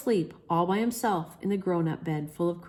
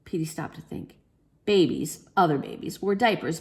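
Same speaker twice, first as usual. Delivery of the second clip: plain reading voice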